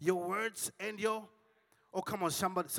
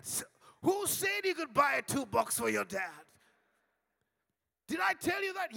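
high loud demand; husband yelling over the two bucks; indignant shout; 8 semitones higher, 5 dB louder than usual. Retold or imitated. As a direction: shout it at her, outraged. Husband shouts in retold quarrel.

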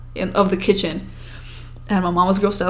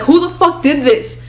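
raised, loud outraged demand; angry scream, retold; 7 semitones higher, 6 dB louder than usual. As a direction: re-enact shouting at the culprit. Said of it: "like, I scream".

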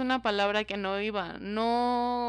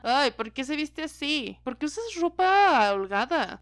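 higher, louder nagging put-on voice; mock nagging critic; 4 semitones higher, 5 dB louder than usual. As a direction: whine at her like a scolding critic. Mimicking critics. (voz chillona de crítica regañona)

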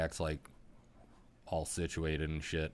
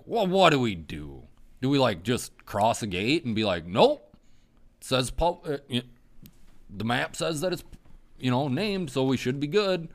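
worked-up retelling; 9 semitones higher, 7 dB louder than usual. Retold or imitated. Retold worried debate.

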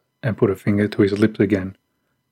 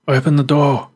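higher, insistent call; 6 semitones higher, 3 dB louder than usual. A narrator's reading. Stranger ordering hidden children to open up, calling out. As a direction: call a firm order through the door. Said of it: "The stranger called out again".

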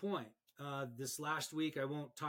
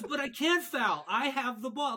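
much higher, louder mock protest; indignant player; 10 semitones higher, 8 dB louder than usual. Player insists to the referee, higher and louder, hammed-up. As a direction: act out a stubborn player's whine. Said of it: "And he goes".

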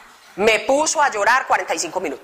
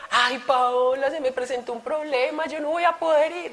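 feeble excuse; 5 semitones higher, 4 dB quieter than usual. Retold or imitated. Brother cancelling on the phone.

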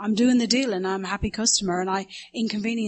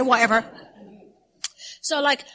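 normal talking voice

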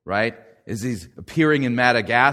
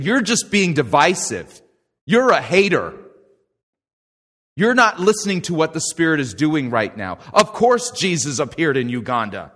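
mock indignant scolding; 4 semitones higher, 6 dB louder than usual. Deceived Christians scold the preacher.

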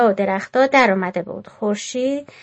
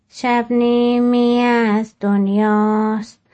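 very slow, measured, louder recital; poem recitation; 5 dB louder, much slower than usual. Acted. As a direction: recite the poem slowly, lingering on each line.

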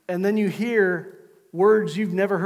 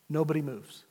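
normal talking voice

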